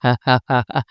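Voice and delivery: short derisive chuckle; derisive laugh. Real, not acted. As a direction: laugh off the rival's reply. Laughing at the rival's reply.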